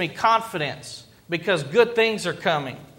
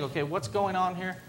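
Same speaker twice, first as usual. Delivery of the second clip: softer, hushed self-question; inner thought; 8 dB quieter than usual. Retold or imitated. Pastor quietly asks himself in his frustration. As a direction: ask it under your breath. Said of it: "I have to stop and think".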